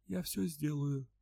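high, soft, slow and fearful; frightened rustling murmur. A narrator's reading. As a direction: whisper it out in fear. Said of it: "испуганно прошелестел".

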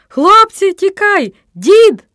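high, very loud warning yell; piercing scream. A narrator's reading. Boy warning friends of the coming grandpa, screaming. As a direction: scream for everyone to run. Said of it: "пролунав пронизливий крик Антончика".

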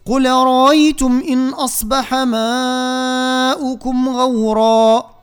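slow melodic tajweed chant; Quran recitation. Shown as a demonstration. Teacher chanting the verse for students, slow and melodic.

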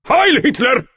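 salute shout. A narrator's reading. Salute to the sergeant.

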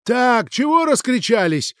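high, slow, commanding landlady; mighty landlady voice. A narrator's reading. Landlady cuts into the women's quarrel, mighty voice. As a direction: break in loudly with drawn-out demands. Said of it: "могучий голос Екатерины Петровны".

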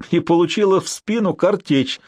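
plain reading voice

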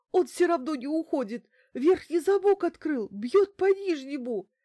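high, loud terrified report; hysterical. A narrator's reading. Bankina reporting that the lock is giving way, hysterical. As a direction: cry each phrase out in high panic.

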